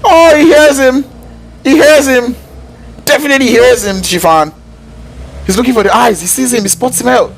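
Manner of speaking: high-pitched, thrilled esports call; excited play-by-play. Real, not acted.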